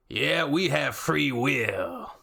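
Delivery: slow, drawn-out mock American voice; slow cartoon American; hammed-up American commenter. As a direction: stretch every word out like a caricature patriot.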